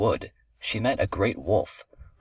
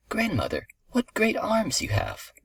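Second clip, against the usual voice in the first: put-on astonished little girl; 12 semitones higher than usual. A narrator's reading. Girl astonished at grandmother's looks, high wondering voice. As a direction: marvel in a high child's voice.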